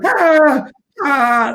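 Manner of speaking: high, loud mock scream; imitated scream. Imitating a wrestler screaming all match, high mock scream. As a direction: scream like a panicking comedy wrestler. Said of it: "he's just screaming".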